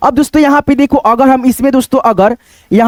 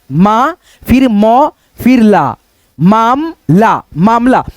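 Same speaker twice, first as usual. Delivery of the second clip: each syllable drawn out slowly for the learner; slow spelling out; much slower than usual. Shown as a demonstration. Teacher spelling मामला slowly for students, drawn out. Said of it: "तो यहाँ पे लिखेंगे मा".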